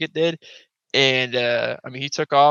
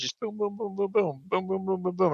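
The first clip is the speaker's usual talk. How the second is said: soft, higher boom boom of distant shots; imitated gunshots; 6 semitones higher, 9 dB quieter than usual. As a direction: mimic far-off rifle fire quietly. Imitating distant gunfire all evening.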